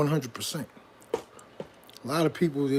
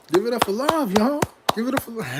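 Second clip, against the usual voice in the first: hype call; 9 semitones higher, 5 dB louder than usual. Pretend. Hyping crowd to cheer for love.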